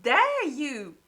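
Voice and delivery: loud, high outraged scolding, slowing at the end; outraged at Skelly. Retold or imitated. Reader yelling at Skelly while reading, loud outrage. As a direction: scold the character like you're yelling at the page. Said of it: "I was just like".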